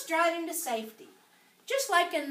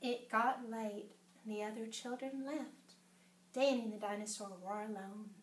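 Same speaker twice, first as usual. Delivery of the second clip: softer, lower, winding down; hushed narration; 5 semitones lower, 10 dB quieter than usual. A narrator's reading. Reader telling children that the friends are now alone, hushed. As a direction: let the story settle quietly. Story turns to a lonely parting.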